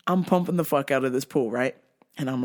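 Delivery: ordinary delivery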